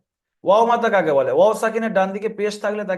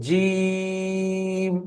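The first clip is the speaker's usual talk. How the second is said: জিম with a very long, level held vowel; three-alif stretch; much slower than usual. Shown as a demonstration. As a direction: draw out the ee of জিম for several beats on one note. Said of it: "তিন আলিফ পরিমাণ টান দিয়ে পড়তে হয়".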